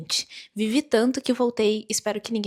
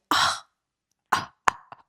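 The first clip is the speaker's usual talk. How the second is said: mock lovestruck gasping; voiceless gasps, as if overcome; swooning gasps; much slower than usual. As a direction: gasp in exaggerated swoon. Swooning at remembered touch.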